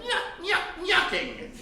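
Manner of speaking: light imitation of a slapstick stooge's catchphrase; Curly Howard. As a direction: toss in a hint of the comic's goofy laugh. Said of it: "of Curly Howard".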